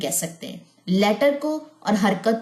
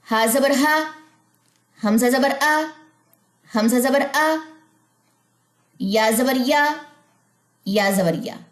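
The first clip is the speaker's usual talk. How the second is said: each syllable set apart, slightly raised; careful letter-by-letter recitation; teacher spelling out letters for the class; spelling drill; 5 semitones higher, 3 dB louder, slower than usual. Shown as a demonstration.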